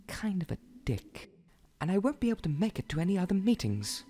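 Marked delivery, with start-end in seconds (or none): 0.1-1.3 breathy whispered insult; hushed aside. Acted. Whispered insult about manager.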